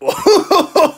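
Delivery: sudden high-pitched laugh; high laugh at the sourness. Real, not acted. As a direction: burst out laughing at the sour taste. Reacting to watermelon sour candy.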